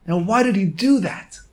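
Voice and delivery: normal talking voice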